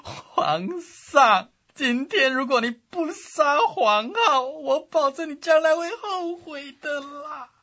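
high, loud tearful pleading; crying plea. Acted. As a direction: beg through tears, voice up high. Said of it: "他就哭着劝阿斗说". (哭着苦劝皇上)

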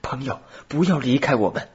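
high-pitched, urgent plea; pleading. A narrator's reading. Robert begging Thalcave not to leave them, pleading. Boy begging his friend to stay.

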